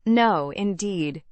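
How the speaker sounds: slow, drawn-out mock earnest no; affected earnestness. A narrator's reading. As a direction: overplay the sincerity, slowly. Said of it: "with affected earnestness".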